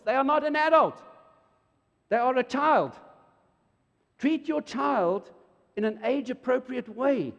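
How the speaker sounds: high, loud, forceful insistence; emphatic preaching. Real, not acted. Preacher to parents, loud emphatic insistence. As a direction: drive the point home to the parents.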